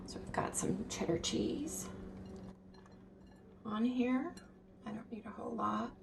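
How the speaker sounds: soft unvoiced whisper. Real, not acted. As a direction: whisper it close to the mic. Whispering while cooking.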